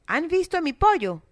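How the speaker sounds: ordinary delivery, nothing marked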